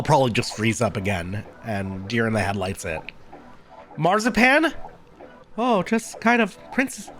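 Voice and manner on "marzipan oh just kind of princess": attempted Princess Peach-style voice, lightly done; a hint of airy princess voice; Marzipan. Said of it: "I was trying to go, like, Princess Peach".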